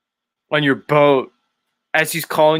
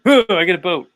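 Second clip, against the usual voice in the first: louder, higher mock reaction; Dave Weiss; 6 semitones higher, 5 dB louder, faster than usual. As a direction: toss out a hammed-up dismissal. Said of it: "that was his reaction".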